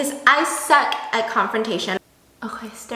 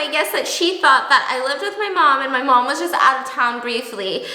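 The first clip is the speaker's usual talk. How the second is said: usual speaking voice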